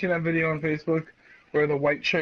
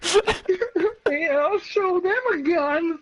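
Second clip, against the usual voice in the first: high, slow hero voice, laughing; mock hero boast; 5 dB louder than usual.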